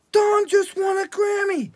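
high, loud, slow shout of amazement; astonished shout. Retold or imitated. Drummer hearing the Grammy news, an astonished high shout.

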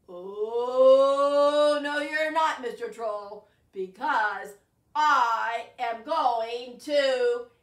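loud, drawn-out defiant goat; big goat facing down the troll, slow and loud; bold defiance. Acted. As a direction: stand up to the troll.

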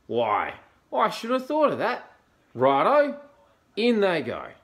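loud, high, eager outburst; excited dingo. Acted. Dingo welcomes the echidna's advice, excitedly. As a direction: jump on the idea at full volume.

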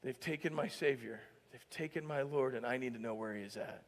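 low, subdued voice; understated, hushed acted reply; very simple response. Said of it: "her response was very simple".

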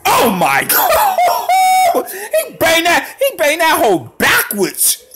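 very high, slow, loud laughing cry; laughing shout of disbelief. Real, not acted. Stunned by the player's backwards dunk, laughing shout. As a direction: explode in amazed laughter.

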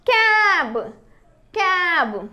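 "క్యాబ్" high, long vowel, falling; pronunciation demo. Shown as a demonstration. Teacher modelling the blended word, "క్యాబ్" high and drawn out. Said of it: "ఎలా పలుకుతుందో చూద్దాము".

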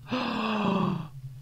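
high shocked gasp; sharp gasp. Real, not acted. Gamer gasps as the character dies suddenly. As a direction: gasp as if startled.

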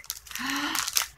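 high, soft gasp of surprise; delighted gasp. Real, not acted. Looking closer at a gorgeous gift, delighted gasp. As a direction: gasp as if amazed.

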